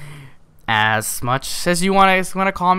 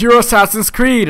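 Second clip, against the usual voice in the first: higher mock kid voice; 8 semitones higher, 3 dB louder than usual. Friend naming the costume.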